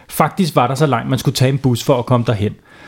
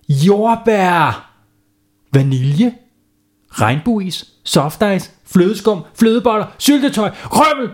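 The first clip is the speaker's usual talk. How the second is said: put-on climbing higher with each topping, kids listing a dream sundae; eager list; 8 semitones higher, much slower than usual. Kids listing toppings to the ice cream man, building excitement. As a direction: rattle off toppings, each one higher than the last.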